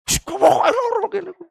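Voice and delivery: very high, strained whine; whining sick complainer. Acted. Pleading sickness to break the fast, high whine. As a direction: insist on the pain in a squeaky put-on whimper.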